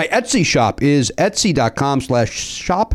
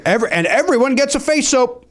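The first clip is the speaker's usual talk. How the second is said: excited; 12 semitones higher than usual. Real, not acted. Excited announcement.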